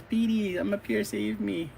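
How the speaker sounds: high-pitched mock plea; damsel calling out. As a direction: call to the hero for rescue. Captive calls hero for rescue.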